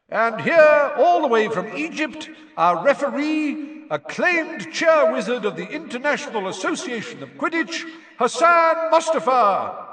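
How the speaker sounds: high, loud, grand introduction; stadium announcer. Acted. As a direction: trumpet the referee's titles, stretching the name at the end. Announcer introduces the referee to crowd.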